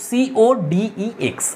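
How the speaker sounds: very slow letter by letter; dictating each letter slowly; slow spelling. Shown as a demonstration. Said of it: "বানানটা দেখো".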